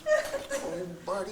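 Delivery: hushed, breathy worry at the screen; soft anxious murmur to a movie hero; Paul. Retold or imitated. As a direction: imitate an engrossed viewer, softly fretting. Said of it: "he starts going".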